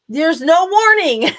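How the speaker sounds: loud, high, slow declaration; emphatic exclamation. Real, not acted. Speaker warns listeners life can end in an instant, emphatic exclamation. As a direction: drive it home at full voice, slowly. Driving home her point, laughing.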